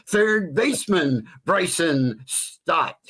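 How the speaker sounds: high, slow stadium call of the name; PA announcer call. Shown as a demonstration. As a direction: stretch the name out over the ballpark. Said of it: "it also could be".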